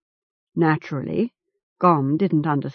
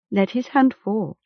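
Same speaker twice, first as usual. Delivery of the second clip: plain reading voice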